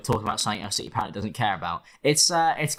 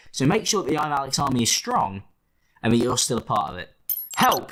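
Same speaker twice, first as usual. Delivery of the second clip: usual speaking voice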